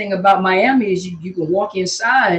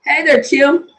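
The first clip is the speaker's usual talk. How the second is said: bright loud greeting; 8 semitones higher, 5 dB louder, slower than usual. Real, not acted. Greeting a viewer.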